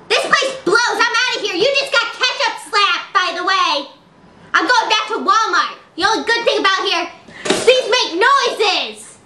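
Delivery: high, fast, whiny voice; high whiny rant. Acted. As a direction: whine it out high as you quit. Quitting the job in anger.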